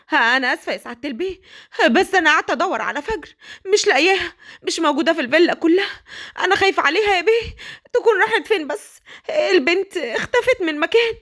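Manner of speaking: high, anxious pleading; worried old nanny. Acted. Nanny telling the father the girl is missing, high and anxious. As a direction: beg the master to worry too.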